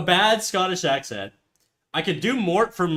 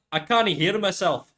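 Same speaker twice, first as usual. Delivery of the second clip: ordinary delivery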